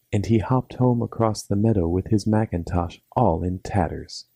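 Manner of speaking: usual speaking voice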